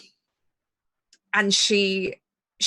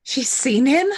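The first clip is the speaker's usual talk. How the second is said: loud, high, disbelieving question; startled, rising in pitch; shocked; 5 semitones higher, 5 dB louder than usual. Acted. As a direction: blurt it out in alarm. Shocked question to Simon in the scene.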